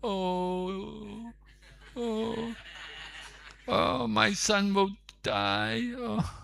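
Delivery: high, soft wailing lament; crying wife. Acted. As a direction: wail softly, a mother who fears for her son. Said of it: "began to cry".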